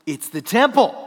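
ringing emphatic answer; loud declaration. Shown as a demonstration. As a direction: announce it boldly to the room. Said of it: "What is that?".

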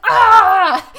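high laughing yelp as he dives; mock yelp. Host imitating Roman's dive yelp, high and laughing. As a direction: squeal like a diving soccer player.

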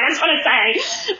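self-mocking, emotional fan voice; gushing. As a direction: imitate yourself gushing at someone you admire. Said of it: "I gushed it like a fucking idiot".

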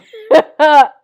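big burst of laughter; loud laugh. Real, not acted. Laughs after punchline.

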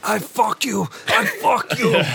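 high, strained mock yell; hammed-up angry foreigner; tall guy shouting. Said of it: "there's the tall guy going".

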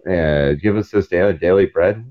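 rattled-off prayer. Retold or imitated. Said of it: "I'm like".

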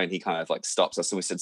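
ordinary talk, nothing marked

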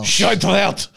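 mimicking German-sounding French; mock German accent. Teasing a friend's French, mock German accent. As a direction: throw it back in a caricatured German accent. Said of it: "you said it like you were German".

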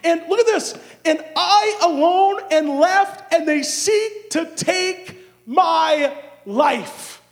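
high plea building to a drawn-out shout; pouring out his heart. Acted. Elijah pours out his heart to God, building to a shouted plea. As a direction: build and build, then stretch out the last cry. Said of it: "Elijah just pours out his heart".

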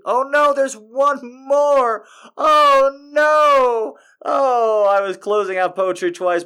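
high, loud squeal of surprise; hammed-up cry of oh no; mock dismay. Real, not acted. Finds another poem to read.

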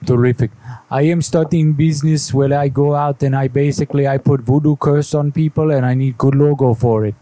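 thick put-on foreign accent; heavy fake accent, broken English. Foreign voodoo businessman voice.

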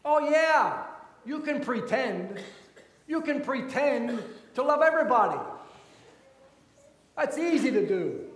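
loud, high burst of mock agreement; loud scoffing. Real, not acted. Preacher calling out pretended love for everybody, loud scoffing.

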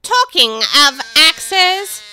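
loud, slow, haughty duchess; imperious plummy grande dame. Acted. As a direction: boom it out like a grand lady. Duchess voice held.

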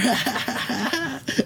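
high cackling villain laugh. Retold or imitated. Urinal stranger bursting into an evil villain laugh. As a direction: cackle like a cartoon bad guy. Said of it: "start laughing like a villain".

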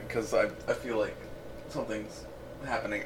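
sultry perfume ad. Pretend. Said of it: "You've got that sultry voice".

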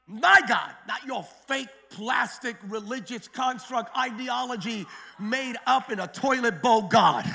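normal talking voice

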